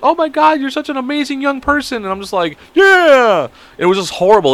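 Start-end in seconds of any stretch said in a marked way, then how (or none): 0.0-2.0 very high, sweet imitation; gushing girl. Imitating the cousin praising him, high gushing voice. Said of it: "she's like". 2.8-3.5 loud, high drawn-out yeah; over-the-top put-on character. Answering her praise in the act, loud high put-on voice. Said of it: "I just went over the top".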